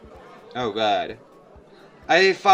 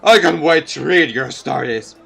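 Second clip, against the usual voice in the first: put-on cheering old hobo; 7 dB louder than usual. Hobo encouraging.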